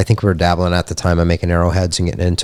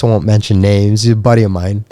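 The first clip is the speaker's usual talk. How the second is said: ordinary delivery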